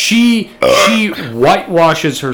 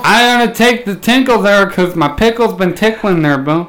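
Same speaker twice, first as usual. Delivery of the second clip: mock Dale voice; 5 semitones higher than usual. Riffing as Dale.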